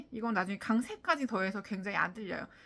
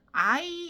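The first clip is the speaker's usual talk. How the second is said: "I" drawn out as a full diphthong; heavy, lengthened "I"; overstressed I; 6 semitones higher, 6 dB louder than usual. Shown as a demonstration. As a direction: say "I" long and full, too slowly. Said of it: "이렇게 하면 이미 늦어요".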